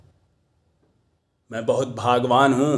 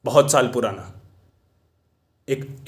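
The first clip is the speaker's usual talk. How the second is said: usual speaking voice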